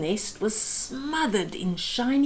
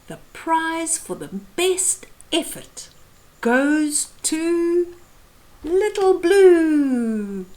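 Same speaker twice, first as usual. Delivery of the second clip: higher, louder, slow drawn-out reveal; grand announcement; 6 semitones higher, 5 dB louder, slower than usual. Acted. Judge announcing the best effort prize, grand reveal. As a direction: build it up like announcing a winner.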